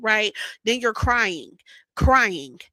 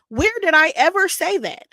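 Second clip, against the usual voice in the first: indignant; 7 semitones higher, 6 dB louder than usual. Real, not acted. Indignant at being lied about.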